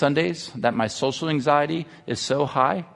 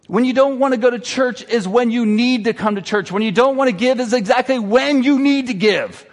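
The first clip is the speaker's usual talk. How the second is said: higher-pitched, forceful sermon delivery; impassioned preaching; 8 semitones higher, 5 dB louder than usual. Real, not acted. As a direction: preach it with rising conviction.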